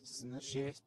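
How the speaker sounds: ordinary delivery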